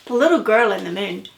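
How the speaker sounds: ordinary delivery, nothing marked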